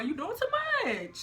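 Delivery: high, fed-up exclamation; exasperated. Retold or imitated. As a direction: push him away, done with it. Ending it with the ex.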